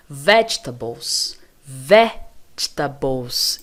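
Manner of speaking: Vegetables slowly as VEDGE-tuh-bols, first syllable strong; slow demo of Vegetables, stressing VEDGE and swallowing the middle; correct pronunciation. Shown as a demonstration. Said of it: "nós vamos falar assim". (Vegetables devagar, VÉDJ forte e depois tabous)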